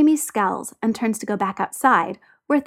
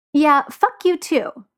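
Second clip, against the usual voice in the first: higher, slow, pointed comeback; snapping angrily; 6 semitones higher, 4 dB louder, slower than usual. A narrator's reading. Tyler before stomping away from Jamie, snapping. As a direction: throw it back with deliberate irritation. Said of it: "Tyler snaps".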